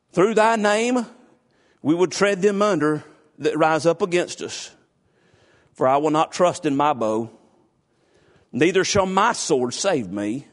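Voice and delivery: measured, solemn reading of a psalm; scripture reading. Acted. Preacher reading a psalm to the church, solemn. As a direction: read the verses out to the congregation.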